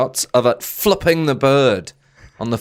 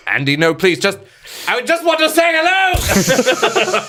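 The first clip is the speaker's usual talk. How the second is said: high, hammed-up desperate plea, much higher; mock pleading; 9 semitones higher than usual. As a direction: beg the goons in a put-on squeak. Pleading with Andy's goons, acted.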